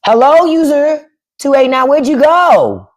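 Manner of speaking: high, singsong call; mock calling out. Streamer calling the troll who left, teasing singsong. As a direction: call after someone missing, stretching the last words.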